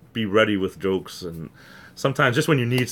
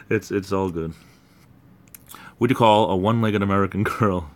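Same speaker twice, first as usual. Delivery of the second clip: normal talking voice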